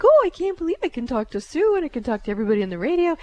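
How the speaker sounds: high, rushed, gushing excitement; bubbly, silly rush of words; giddy and silly. Retold or imitated. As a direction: imitate a giddy spirit, fast and high. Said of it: "she's kinda giddy and silly".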